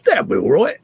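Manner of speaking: high-pitched mock Cockney; squeaky teasing Cockney impression; imitated Cockney accent. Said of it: "was that your Fox Cockney accent coming out again?".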